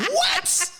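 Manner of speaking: shrieking high disbelief; a sudden squeak of shock; jaw-dropped what; stunned what. Retold or imitated. Stunned by news of going home.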